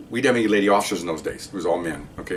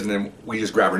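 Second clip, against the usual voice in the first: normal talking voice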